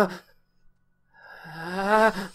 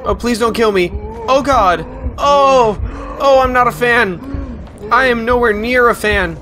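high, loud panicked yelps; panic, it's chasing you; scared horror game streamer; 13 semitones higher, 8 dB louder than usual. Real, not acted. Fleeing a monster in game.

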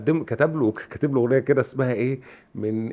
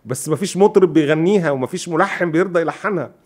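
eager retold offer; 6 semitones higher, 5 dB louder than usual. Lyricist eagerly offers more songs, retold.

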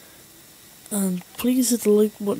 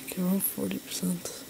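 whispering; 7 dB quieter than usual. Real, not acted. Hiding, whispering progress.

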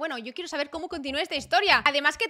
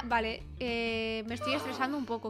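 normal talking voice

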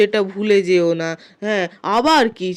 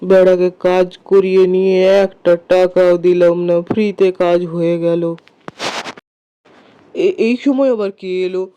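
greedy landlord; 4 dB louder than usual. Acted. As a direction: gloat like a stingy zamindar. Miserly landlord gloating, refusing pay.

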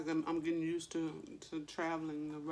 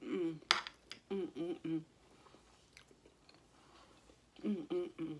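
humming while chewing; talking after swallowing food; chewing with mouth full; 2 semitones lower, 5 dB quieter than usual. Real, not acted. Seen 1: Bites food at -3, chews with closed mouth through humming.

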